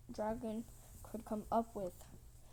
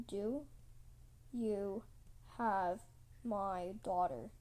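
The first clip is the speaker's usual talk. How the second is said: word by word: DO... YOU... HAVE... MY DAUGHTER; grave, deliberate questioning; 3 semitones lower, slower than usual. Acted. Queen finally asks the big question, word by word. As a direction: pause after DO, YOU and HAVE.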